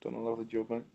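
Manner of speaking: hushed teasing compliment on the paint job; softly mocking praise of the paint job. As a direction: say it quietly with a smirk, like a mock compliment.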